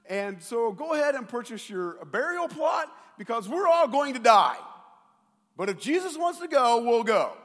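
loud, high mock-doom sarcasm; a sarcastic disciple yelling that they'll all die; sarcastic Thomas. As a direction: shout it like a doomed grumbler rolling his eyes. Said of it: "sense the sarcasm in Thomas's voice".

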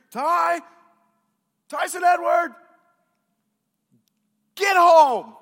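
imitated mom's full-throated yell, with pauses between. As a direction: holler the kid home from houses away. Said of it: "how long she'd been yelling this".

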